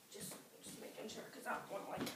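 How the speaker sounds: hushed whisper, checking nobody hears; real nervous whisper. Real, not acted. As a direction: whisper so mom won't hear. Startled by a noise, checks quietly.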